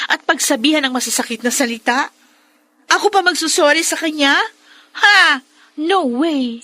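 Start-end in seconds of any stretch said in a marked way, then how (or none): 2.9-6.6 indignant outburst. Acted. Daughter refuses to apologize. (galit na hindi makapaniwala, palakas)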